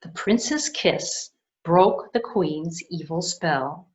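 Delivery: usual speaking voice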